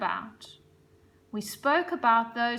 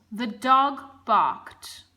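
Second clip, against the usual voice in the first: "the dog barked." read slowly and a bit louder; slow, deliberate example; 6 dB louder, much slower than usual. Shown as a demonstration. Teacher reading a sentence example, slow and clear. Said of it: "For example".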